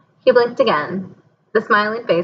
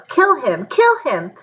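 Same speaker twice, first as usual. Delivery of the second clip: yelling; 5 semitones higher, slower than usual. A narrator's reading. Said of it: "Voldemort yelled".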